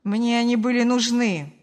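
loud, unyielding retort; hard, harsh reply. A narrator's reading. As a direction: answer hard, with no apology. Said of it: "жёстко ответил сын".

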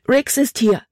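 high, urgent outcry; high-pitched cry of warning; exclaiming. A narrator's reading. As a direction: blurt out the alarming news. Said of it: "rief sie aus".

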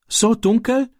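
high, scared, stumbling question; a boy afraid of the dark, voice jumping up; frightened stammer. A narrator's reading. Ram dreading the dark walk home, stammering in fear. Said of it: "stammelte Ram".

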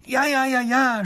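high, loud eager cry of yes; a small girl's voice straining to carry; princess shrieking. Acted. Princess calls over the river, eagerly agreeing. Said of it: "schreit die Prinzessin".

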